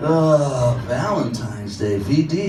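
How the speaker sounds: ordinary delivery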